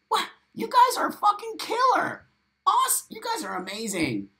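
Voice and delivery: very high, excited squeal of admiration; thrilled praise. Real, not acted. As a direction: gush at the top of your range. Delighted by community members' offers.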